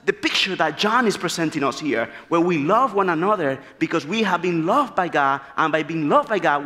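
ordinary talk

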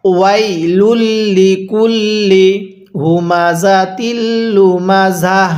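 slow, drawn-out tajweed recitation; Quran recitation. Shown as a demonstration. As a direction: recite the sura in full for revision. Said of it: "একবার revision দিব".

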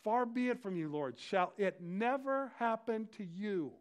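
loud, high, emphatic rebuke; rebuking. Acted. Peter to Jesus after his prediction, rebuking him. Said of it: "he rebukes him and he says".

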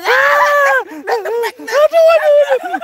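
very high yelps of alarm; panicked shriek. Real, not acted. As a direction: shriek it in sheer panic, pitch leaping. Panicked yelling during ghost encounter. (njerit dhuwur amarga panik)

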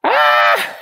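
high triumphant witch shriek; witch cackle. Acted. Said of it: "said the witch".

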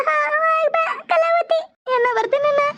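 high-pitched wailing goodbye; crying farewell. Acted. Family weeps as bride leaves. (ಜೋರಾಗಿ ಅಳುತ್ತಾ ಬೀಳ್ಕೊಡುಗೆ)

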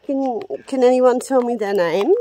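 loud call to the room. Real, not acted. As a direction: project it out to unseen spirits. Calling out to spirits.

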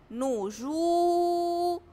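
slow "نجو", the oo held loud and long; long waw vowel. Shown as a demonstration. Teacher concludes on madd with waw, "نجو" loudly prolonged. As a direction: sustain "جو" strongly on one note. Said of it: "المد بالواو".

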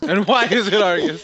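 laughing. Real, not acted. Repeating the question while laughing.

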